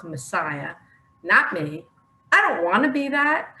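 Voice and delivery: usual speaking voice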